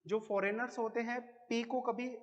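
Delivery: ordinary talk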